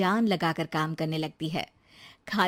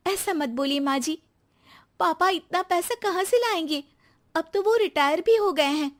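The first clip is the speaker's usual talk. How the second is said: lightly raised voice of a hurt new bride; young wife Rekha; 9 semitones higher than usual. Acted. New bride answers her husband, a touch hurt.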